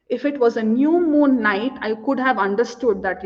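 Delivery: ordinary talk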